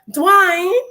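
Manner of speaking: high drawn-out Southern belle Dwayne; Whitley's Dwayne. Retold or imitated. Calling boyfriend Dwayne like Whitley, high Southern belle. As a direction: call Dwayne like Whitley, high and sing-song. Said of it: "call him like that".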